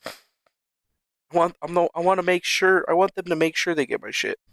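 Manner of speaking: normal talking voice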